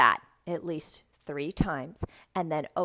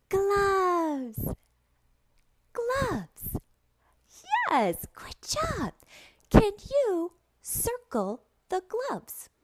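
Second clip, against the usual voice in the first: very high, slow, delighted delivery; exaggerated high-pitched classroom enthusiasm; excited kids' teacher; 14 semitones higher, much slower than usual. Shown as a demonstration. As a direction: marvel at it for a small child. Shows prop to imagined child.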